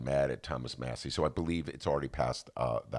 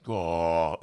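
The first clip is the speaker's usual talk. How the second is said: ordinary talk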